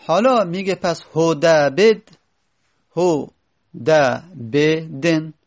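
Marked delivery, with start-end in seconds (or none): 3.8-5.3 هدبدن stretched out very slowly; slowly sounded هدبدن; slow demo word. Shown as a demonstration. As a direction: spell هدبدن out vowel by vowel. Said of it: "الان چهار حرکت پشت سر هم بود دیگه،".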